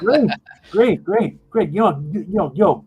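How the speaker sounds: high-pitched, eager leprechaun boss; excited band leader. Acted. Leader sending new dancers to costumes, high eager voice.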